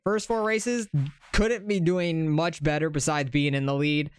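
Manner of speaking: usual speaking voice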